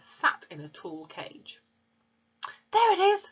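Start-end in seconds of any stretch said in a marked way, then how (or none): 2.7-3.3 put-on high, proud little boy's voice; small boy. A narrator's reading. Fred showing Matilda his parrot, high proud boy voice. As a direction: show it off like a six-year-old. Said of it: "said Fred".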